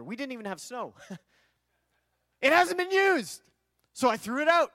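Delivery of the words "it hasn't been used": high, drawn-out protest; indignant insistence. Retold or imitated. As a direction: insist on it, pitched way up and slower. Retelling his indignant justification.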